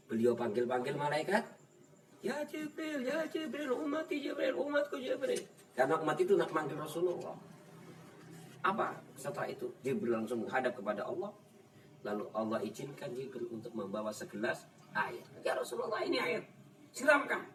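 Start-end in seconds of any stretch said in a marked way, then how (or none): 2.2-5.4 high-pitched pleading call; calling Jibril. Retold or imitated. The Prophet calls Jibril to save his people, pleading high. 15.4-17.4 raised, high urging; Jibril's voice. Retold or imitated. Jibril urges the Prophet to pour the water, high.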